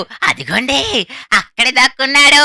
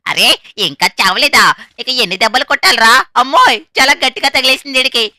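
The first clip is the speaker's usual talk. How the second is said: normal talking voice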